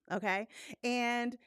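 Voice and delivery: ordinary talk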